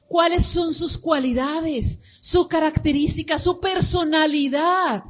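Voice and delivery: slow, loud, high preaching, every word pressed; preaching at full force. Acted. Pastor preaching to the church about knowing God, loud and slow. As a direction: drive it home from the pulpit.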